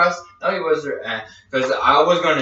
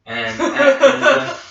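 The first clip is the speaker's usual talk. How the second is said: laughing; 11 semitones higher, 7 dB louder than usual. Real, not acted. Laughing at friend's dad.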